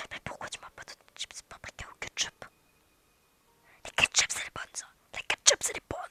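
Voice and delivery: hushed whispered complaint; whispering. Real, not acted. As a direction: whisper it like a secret. Whispered complaint about chip flavor.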